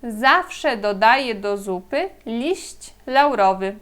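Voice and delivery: Zawsze dodaję do zupy liść laurowy read slowly; slow model sentence. Shown as a demonstration. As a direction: read the Polish sentence carefully for learners. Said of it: "Vamos ver a frase".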